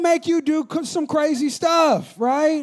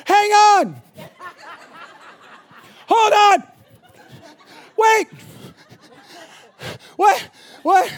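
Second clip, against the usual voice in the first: high, loud strained shout; calling out; 8 semitones higher, 7 dB louder, much slower than usual. Retold or imitated. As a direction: yell back at the drive-thru worker, exasperated. Calling back to drive-thru worker.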